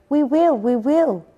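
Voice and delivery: high, loud chorus of little chicks; eager promise. A narrator's reading.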